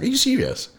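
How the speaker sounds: very high-pitched, retold disbelief; incredulous. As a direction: squeak it out as if you can't believe the news. Stunned at getting the job.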